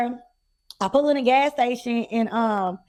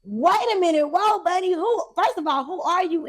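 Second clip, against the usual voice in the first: retold shock, higher pitch; 6 semitones higher than usual. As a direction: pull them up short in mild disbelief. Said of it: "shocked, like".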